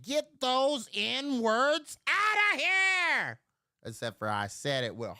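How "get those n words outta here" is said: higher, slow mock yell; retold yell. Telling his uncle off, mock yell. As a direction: hammer it out like a rant.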